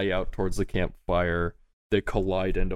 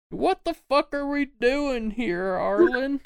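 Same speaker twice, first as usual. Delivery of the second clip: very high, slow comic panic voice; frightened henchman; slower than usual. Acted. Henchman to his boss after thunder: high scared whine. Frightened man complains to leader.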